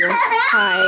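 squeaky sweet hi to the dog. Real, not acted. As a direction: pitch way up for the pet.

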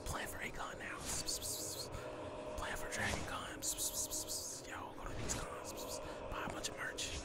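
hushed whisper to himself. Real, not acted. Host whispering his convention plans to himself. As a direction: whisper the plan like a secret.

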